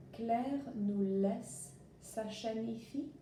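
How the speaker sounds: slow, careful French question; slow French reading. Shown as a demonstration. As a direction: read the French slowly for learners. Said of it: "I'm gonna read the one with".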